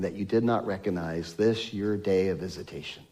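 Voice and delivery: bitter lament. Retold or imitated. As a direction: mourn over the city, almost breaking. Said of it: "Jesus wept bitterly".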